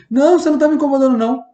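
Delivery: high, loud, fast fake denial; insincere reassurance. Shown as a demonstration. Speaker faking reassurance to a bothered colleague, high hurried. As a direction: overdo it so it rings false. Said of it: "eu vou falar assim".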